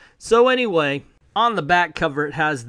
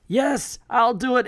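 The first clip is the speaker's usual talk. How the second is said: much higher, eager agreement; eager high yes; 9 semitones higher, 3 dB louder than usual. Acted. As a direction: jump at the offer, pitched up. Larfleeze eagerly accepts.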